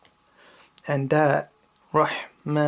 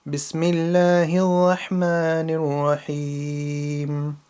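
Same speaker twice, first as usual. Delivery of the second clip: measured, melodic Quranic recitation; 4 semitones higher, 4 dB louder than usual. Shown as a demonstration. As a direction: recite it with full tajweed, letting each phrase ring.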